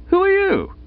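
high startled question; flustered and jumpy. Acted. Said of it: "visibly shaken".

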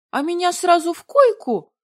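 high, loud indignant screech; thin squealing note. A narrator's reading. Heroine accusing the dragon, high squealing outrage. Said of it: "закончила на такой тонкой и визгливой ноте".

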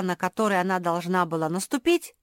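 ordinary delivery

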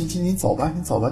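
ordinary talk, nothing marked

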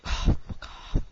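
breathy whispered exasperation; hushed mock despair; whispered oh my god. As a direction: mutter it in disbelief under your breath. Retold exasperated reaction.

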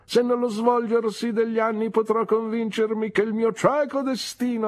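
pompous farewell, fading at the end; Mr Micawber. Acted. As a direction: declaim it from the back of the coach.

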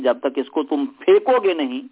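ordinary delivery

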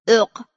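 short retching "ugh"; disgusted gag. Acted. Disgusted noise after the doctor's speech. (صدای عق زدن از انزجار)

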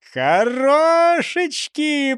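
high, drawn-out cheer; delighted praise. A narrator's reading. Густав praises the big blue bubble, delighted drawl. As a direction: stretch it out in high-pitched admiration. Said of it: "похвалил Густав Гейер".

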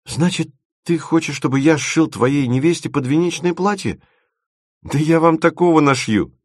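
high-pitched, mounting protest; indignant disbelief. A narrator's reading. Timofeev balks at sewing the dress, indignant. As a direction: build from questioning to exclaiming.

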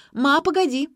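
high, loud urgent call; calling after. A narrator's reading. Daughter rushing after her departing mother, calling urgently. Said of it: "бросилась за ней Ксюша".

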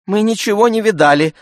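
quick, high female voice; women's voices. A narrator's reading. Two women denying they saw the slap, quick denial. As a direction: hurriedly deny it in a high voice. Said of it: "сказали обе женщины в один голос".

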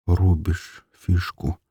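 slow slack-lipped echo; limp lips. Acted. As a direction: repeat it numbly, lips barely moving. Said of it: "повторил вялыми гусеницами губ Толя".

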